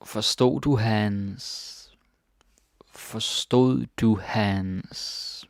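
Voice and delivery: very slow, drawn-out teaching pace; slow model reading. Shown as a demonstration. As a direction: stretch each word out for learners. Teacher models the phrase slowly.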